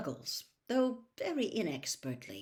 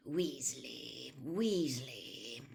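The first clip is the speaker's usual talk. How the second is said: softer, lower, half-whispered name search; muttered to himself; 6 semitones lower, 5 dB quieter, much slower than usual. Acted. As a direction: mumble while scanning the list. Muttering while searching list.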